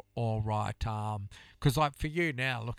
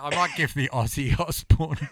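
ordinary delivery

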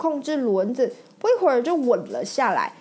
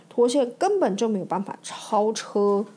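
ordinary delivery, nothing marked

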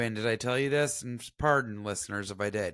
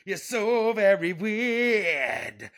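high, slow, exaggerated scolding; mock scolding; 9 semitones higher, 4 dB louder, much slower than usual. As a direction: scold like an outraged diva.

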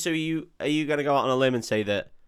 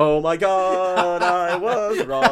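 mock surprise; 5 semitones higher, 5 dB louder, much slower than usual. Mock shock at own mistake.

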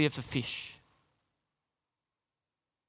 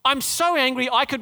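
whiny, higher angry quote; sulky Jonah; 7 semitones higher, 4 dB louder than usual. Retold or imitated. Preacher voicing Jonah's tantrum to the congregation. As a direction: play the sulking child prophet. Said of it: "do you remember what he says".

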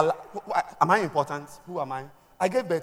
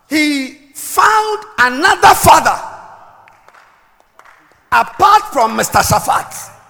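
loud, emphatic preacher delivery; forceful preaching; 10 semitones higher, 13 dB louder than usual. Acted. Preacher teaching on Elisha's two fathers, forceful preaching. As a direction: drive each phrase home to the congregation.

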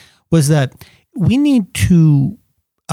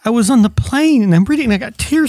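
higher-pitched imitation of an upset caller; lightly mock-distressed, pitched up; retold upset; 9 semitones higher, faster than usual. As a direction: retell her distress in a raised, hurried voice. Retelling a friend's upset phone call.

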